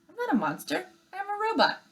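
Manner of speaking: high, earnest robot protest. Acted. Robot insisting to the bears she is no monster, high and earnest. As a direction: insist politely, high and clear.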